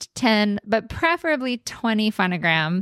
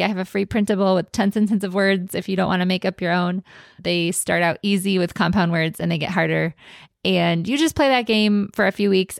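ordinary talk

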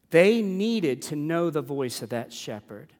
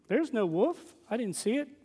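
mock sheepdog excuse; 6 semitones higher, 4 dB quieter than usual.

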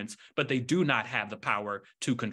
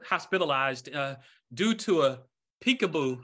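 telling a dad joke; 5 semitones higher than usual. Acted. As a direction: build to the punchline. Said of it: "you got that dad joke locked".

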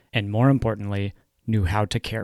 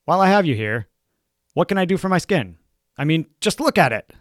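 imitated patient, pitched up and louder; imploring patient; 7 semitones higher, 6 dB louder than usual. Patient asking the dermatologist for skin advice, higher, louder imitated plea. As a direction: play an eager patient's plea, high and loud. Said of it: "people will often ask me".

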